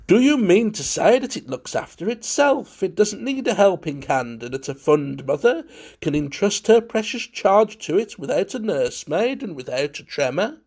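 high, lively astonished question; crying out enthusiastically. A narrator's reading. Valentine keeping a flagging joke alive, crying out enthusiastically. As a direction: summon forced galvanic enthusiasm. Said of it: "he cried out".

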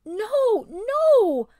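high, loud anguished howl. Acted. Beast protests to the ape, howling. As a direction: howl in disbelief. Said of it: "howled the beast".

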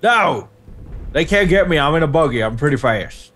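ordinary talk

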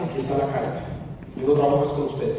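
low, subdued proud resignation; retold haughty quitting, pitched low. Employee handing his boss the resignation letter, proud and low. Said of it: "yo orgulloso le digo".

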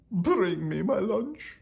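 high, drawn-out royal command; hammed-up kingly demand. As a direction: order it slowly like a cartoon king.

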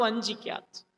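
a son shrinking as he admits fear to his mother; frightened confession. Acted. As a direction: confess the fear almost under the breath.

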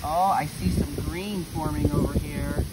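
usual speaking voice

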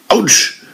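a sudden ouch from a poke in the chest; cry of pain. A narrator's reading. Arne poked hard in the chest, high yelp of pain. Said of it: "schrie Arne".

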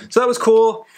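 bright upbeat lift on the last word; excited. Real, not acted. Telling viewers the citizenship test went easy, excited. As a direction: tell it like a small win.

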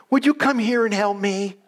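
high-pitched retold appeal; calling over. Blind old lady asking a schoolboy for help, calling over. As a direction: call them over, pitched well up.

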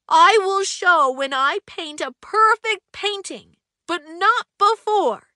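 a shy painter loudly begging his friend to keep a secret; pleading Misty. Acted. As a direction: beg him not to tell. Misty insists on keeping the secret.

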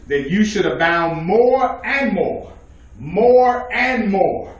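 slow, drawn-out preacher's delivery; emphatic scripture reading. Real, not acted. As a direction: stretch out each word for the congregation.

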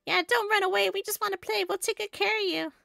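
high, singsong cartoon voice; coaxing game character. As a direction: coax the beetle in a high little voice. Voicing game characters coaxing the beetle.

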